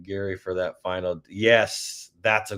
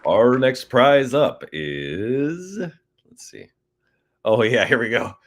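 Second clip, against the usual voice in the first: slow, booming radio announcer; hammed-up radio host; 2 semitones higher, 5 dB louder, slower than usual. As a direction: announce it like a DJ on air. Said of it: "Let me try my radio voice".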